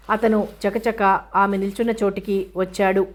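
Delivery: usual speaking voice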